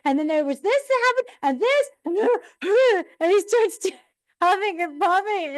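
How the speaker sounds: very high, squeaky flustered dad; mock-flustered dad, high; dad's flustered excuse. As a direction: sputter out an excuse, pitch way up. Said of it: "he's just like".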